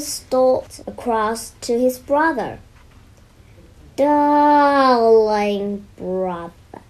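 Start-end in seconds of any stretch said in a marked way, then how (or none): 4.0-6.8 slow, silky purred greeting; purring. Acted. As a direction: purr it like a sly cat. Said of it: "he purred".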